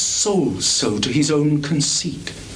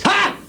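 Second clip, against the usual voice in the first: a much higher barked ha; sharp scornful ha. Acted. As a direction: spit out a bitter ha. Sudden outburst.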